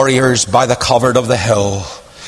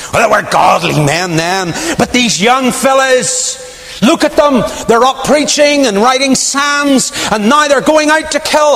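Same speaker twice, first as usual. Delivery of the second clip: gossiping woman; 11 semitones higher than usual. Retold or imitated.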